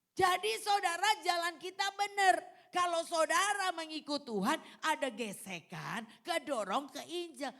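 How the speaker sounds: loud, high exhortation to the congregation; ringing, high-pitched pulpit call; full-force preaching. Real, not acted.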